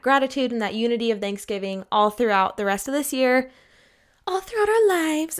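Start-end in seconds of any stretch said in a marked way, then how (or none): 4.3-5.4 high, over-the-top earnest; mock sentimental. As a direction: gush it like a greeting card. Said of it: "No, I'm just kidding".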